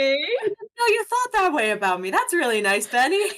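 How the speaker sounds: high, lilting flattered voice; lifted, sweetly flattered tone; Caleb, touched. Acted. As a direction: gush in a high pitch, pleasantly surprised. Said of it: "And Caleb's like".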